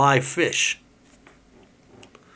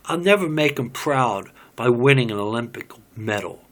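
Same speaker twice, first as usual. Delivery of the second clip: ordinary talk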